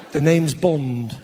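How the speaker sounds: deep, slow Bond catchphrase, lost; a slow, low, senile James Bond; confused old Bond. Pretend.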